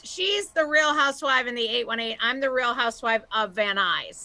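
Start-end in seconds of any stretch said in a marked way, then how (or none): none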